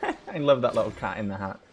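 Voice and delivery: ordinary talk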